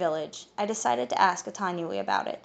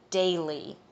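growled. A narrator's reading. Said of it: "he growled".

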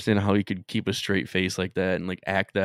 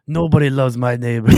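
mock Borat; 5 semitones higher, 8 dB louder than usual. Quoting Borat.

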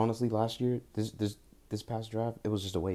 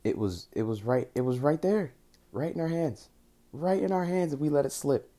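anguished; 8 semitones higher, 5 dB louder than usual. Real, not acted. Lamenting the lost Super Bowl.

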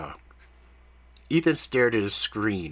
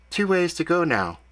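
lightly voiced calm female handler, a bit higher; brief the partner coolly, slightly raised pitch; Kat, composed handler; 9 semitones higher, 3 dB louder than usual. A narrator's reading.

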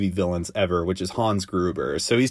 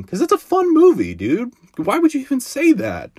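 high-pitched squeal of protest; playfully outraged, squeaky and incredulous; mock indignation; 3 dB louder than usual. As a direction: whine in disbelief, voice leaping way up. Mock outrage at a critic's opinion.

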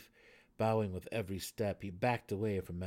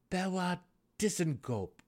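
loud, eager blurt; 10 semitones higher, 6 dB louder than usual. A narrator's reading. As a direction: burst out with the name. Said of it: "Drizzt spouted".

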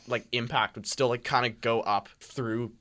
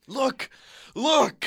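excited; 13 semitones higher, 6 dB louder than usual. Retold or imitated. Cousin voiced by listener.

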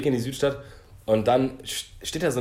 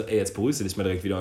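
quick inner voice; 4 semitones lower, much faster than usual. Retold or imitated. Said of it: "ich hab mir gedacht so".

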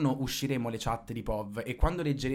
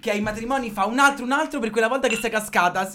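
high, loud pushy aunt voice; annoying aunt; 7 semitones higher, 7 dB louder than usual. Acted. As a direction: play the nagging aunt at a wedding.

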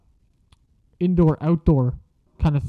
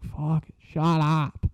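annoyed mutter; 5 dB quieter, much slower than usual. Retold or imitated. Classmates singing Wagon Wheel again.

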